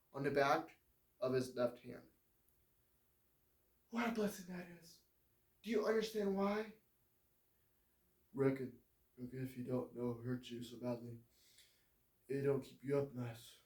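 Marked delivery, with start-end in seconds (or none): 3.9-6.7 higher, lighter woman's voice; frail woman. Acted. Dying warden's wife asks John about his scars, high and gentle. 8.3-13.6 southern drawl. Acted. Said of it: "in his almost southern accent".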